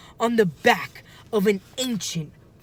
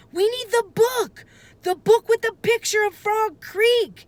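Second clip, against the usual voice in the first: panicked; 4 dB louder than usual. A narrator's reading. Jack panicking for the book.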